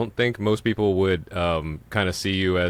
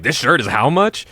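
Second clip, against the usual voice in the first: shocked at price; 6 semitones higher, 6 dB louder than usual. Retold or imitated.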